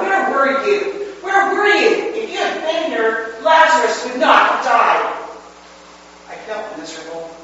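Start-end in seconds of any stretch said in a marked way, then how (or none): 0.0-5.1 high, loud anguished cry; loud heartbroken reproach; cried out. A narrator's reading. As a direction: hurl the grief at him as an accusation. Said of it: "as I cried out".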